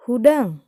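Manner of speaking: "Hudang." shouted high and loud, falling sharply; command intonation. Shown as a demonstration. Teacher showing pupils how a command sounds, loud and falling. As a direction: give "Hudang." as a firm order. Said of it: "dina intonasi nyariosna oge beda".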